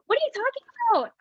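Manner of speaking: high, fast, mock-shocked outburst; incredulous reply. Daughter shocked at mom's claim, high mock disbelief. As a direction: squeak it out in disbelief. Retold indignant reply to mom.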